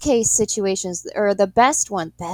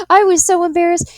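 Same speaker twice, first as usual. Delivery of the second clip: high and loud through laughter; embarrassed laughing confession; 12 semitones higher, 12 dB louder than usual. Real, not acted. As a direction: laugh the words out, giggling at yourself.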